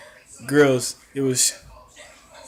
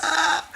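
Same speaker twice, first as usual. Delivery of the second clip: short high-pitched reenacted scream; mock high scream. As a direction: squeal as if stunned by a knockout. Replays own scream at knockout.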